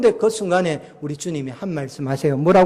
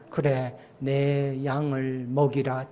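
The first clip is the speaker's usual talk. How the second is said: tender commission; 4 semitones lower, 6 dB quieter, much slower than usual. Retold or imitated. Risen Jesus tenderly commissions Peter.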